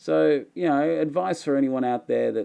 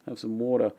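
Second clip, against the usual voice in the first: ordinary talk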